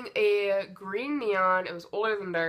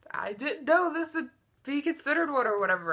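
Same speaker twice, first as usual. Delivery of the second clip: whiny excuse; 7 semitones higher than usual. Retold or imitated. Boyfriend excusing himself to cops.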